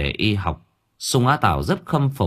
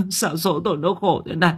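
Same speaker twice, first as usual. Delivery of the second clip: lamenting; 8 semitones higher than usual. Acted. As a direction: sob out the complaint at fate. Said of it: "đang vật vã gào khóc".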